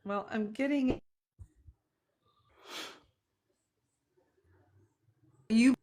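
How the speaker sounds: choppy, dropping-out call audio; broken-up video call line; glitching audio. Real, not acted. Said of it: "you're breaking up a little bit".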